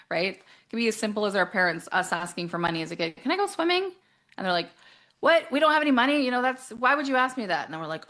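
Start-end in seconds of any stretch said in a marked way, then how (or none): 3.2-3.9 put-on child asking. Child asking parents for money. 5.2-7.6 higher, louder mock parent snapping; annoyed parent. Parents snapping at asking child.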